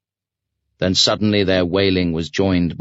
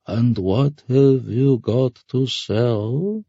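slow, higher drawl rising at the end; mocking ferryman; 5 semitones higher, much slower than usual. Ferryman asking Sasha for payment, mocking drawl. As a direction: draw out every word like a sly haggler.

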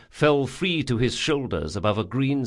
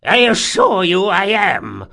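put-on high, loud old man's roar; indignant roar; 9 semitones higher, 9 dB louder than usual. A narrator's reading. Old man insists he is not senile, roaring. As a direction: roar it in outrage. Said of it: "roared Cordo".

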